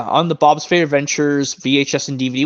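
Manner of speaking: ordinary talk, nothing marked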